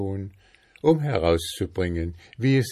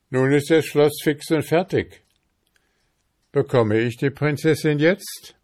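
high, louder call with a hopeful question; 7 semitones higher, 5 dB louder than usual. A narrator's reading. Boy asks the king for the princess, calling out. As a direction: announce it high, then press the question. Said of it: "rief er".

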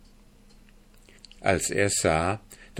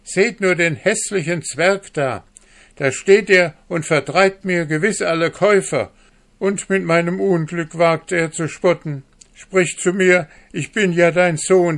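high, loud outraged tirade; angry railing; 9 semitones higher, 7 dB louder than usual. A narrator's reading. Hanne denouncing the dwarf to the market women, loud outrage. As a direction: rail at him for the whole market to hear.

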